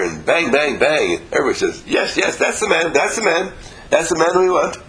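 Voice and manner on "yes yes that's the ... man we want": higher-pitched, eager cheering on; excited, urgent endorsement in a raised pitch; acclaiming crowd. Acted. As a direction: act out a crowd hailing its man. Acting out an enthusiastic crowd.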